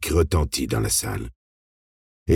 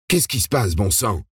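higher, near-panic yelp; panicky yelp; 5 semitones higher than usual. A narrator's reading. Frightened man reacting to the attack, panicky yelp. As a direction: blurt it on the edge of panic. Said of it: "glapit un autre homme au bord de la panique".

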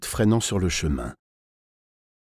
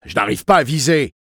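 loud, higher, exasperated voice; annoyed exclamation; 5 semitones higher, 6 dB louder than usual. A narrator's reading. Torturer unable to aim at the boy's eye, annoyed outburst. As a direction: complain loudly in irritation. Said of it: "s'exclama-t-il avec agacement".

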